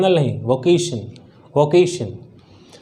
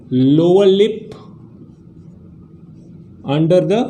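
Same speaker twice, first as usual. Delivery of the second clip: slow dictation; much slower than usual. Shown as a demonstration. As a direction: spell out the technique slowly. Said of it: "what is the technique to speak V". (धीरे-धीरे समझाते हुए)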